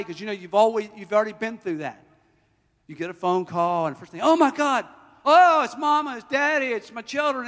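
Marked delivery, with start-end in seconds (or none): 4.2-7.5 high, loud cries of alarm; mock panic. Preacher acting out dread at a phone call, panicked. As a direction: blurt it out in escalating alarm.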